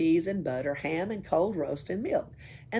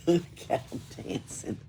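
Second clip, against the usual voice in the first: laughing; 5 dB louder than usual. Real, not acted. Reader laughing at the dancing burglar picture. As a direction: whisper it through a giggle. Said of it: "reader laughing at the picture".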